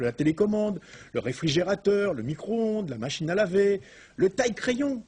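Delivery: high, rising pitch on each item; amazed listing. Real, not acted. Host reveals everyday inventions to the audience, amazed. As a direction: build to an astonished peak on the last item.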